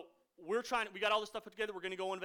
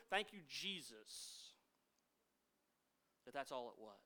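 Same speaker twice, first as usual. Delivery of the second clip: relieved prayer; 6 semitones lower, 9 dB quieter than usual. Retold or imitated. Relieved prayer after car trouble.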